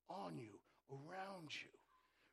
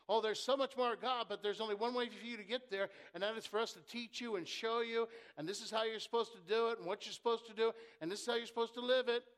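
quick, pushy false-teacher voice; mock false teacher; 3 semitones higher, 7 dB louder, faster than usual. Preacher mocking the false teachers to the church, pushy and quick. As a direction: rattle off the heresy like a salesman. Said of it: "which was coming in and saying".

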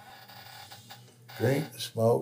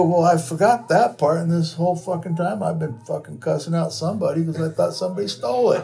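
excited rant; 7 semitones higher, 5 dB louder, faster than usual. Retold or imitated.

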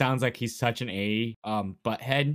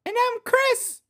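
very high chipmunk squeak; squeaky high voice; 4 dB louder, slower than usual. Acted. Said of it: "exactly what my voice sounds like".

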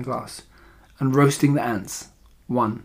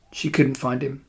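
ordinary delivery

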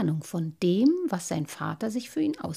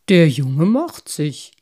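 slow, loud, weighty praise; big, deliberate merman father; approving father; 3 semitones lower, 12 dB louder, slower than usual. A narrator's reading. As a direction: nod it out with fatherly pride. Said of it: "sagte der Wassermann Vater anerkennend".

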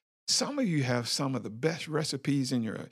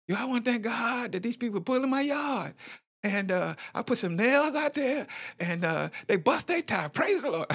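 mock church testifier; 12 semitones higher than usual. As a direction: ham up a church member's silly testimony. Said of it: "people testify stuff like".

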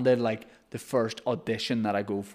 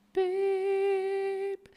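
high, steady mock beep; beep noise. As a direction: imitate a record beep. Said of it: "I do the noise for the tapes".

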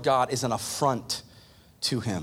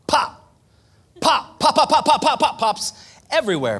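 loud high pop pop pop; popping sounds; 10 semitones higher, 7 dB louder than usual. Acted. Preacher to congregation, loud popping imitation. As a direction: burst out each pop like a kernel.

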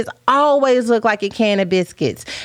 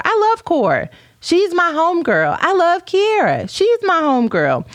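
gushing; 8 semitones higher than usual. Real, not acted. Gushing about friends on live.

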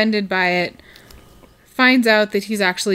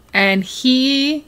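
ordinary talk, nothing marked

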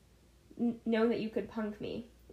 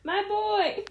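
loud, high, laughing call back; yelled back; 10 semitones higher, 6 dB louder than usual. Retold or imitated. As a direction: holler it back cheerfully. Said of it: "and he yelled back".

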